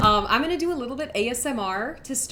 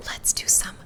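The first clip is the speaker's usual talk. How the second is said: whispering. Real, not acted. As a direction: whisper gently for the ASMR stream. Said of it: "so I have to whisper now".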